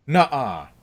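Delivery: loud incredulous nuh-uh; disbelieving. Retold or imitated. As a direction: say it like you can't believe it. Dad's disbelief, louder.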